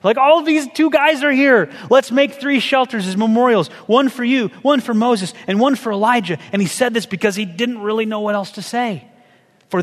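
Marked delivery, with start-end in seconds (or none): 0.2-6.4 excited Peter. Retold or imitated. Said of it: "Can you imagine how excited he was? Like".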